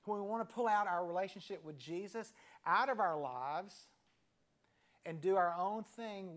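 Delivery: normal talking voice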